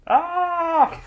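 high, loud cartoon scream; high-pitched pretend cry of terror; mock scream. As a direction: let out a hammed-up scream.

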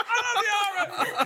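high, drawn-out squealed shout; mock fangirl yell. Teasing a friend being hooked off stage, mock yell. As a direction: cry out your love as you're hauled away. Mimics fan dragged off stage.

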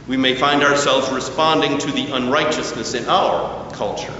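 normal talking voice

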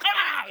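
sharp high imitated yelp; mock dog yelp. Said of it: "and dog goes".

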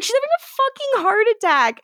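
high-pitched mock panic; hammed-up urgent cry; retold alarm.

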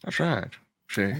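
gruff hard-boiled detective voice; noir detective. Pretend. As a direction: play a forties private eye. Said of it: "You have such a better noir voice".

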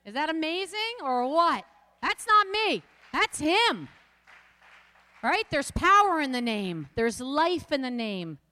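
loud, high, ringing proclamation; preaching at full force. Real, not acted. Preacher exulting to the congregation, loud and high. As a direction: shout the praise to the room.

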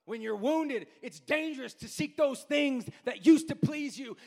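loud, high, shouting sermon climax; full-force preaching. Acted. Preacher warning the congregation about woundedness, shouting and half-singing. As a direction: preach it at the top of your voice, almost singing.

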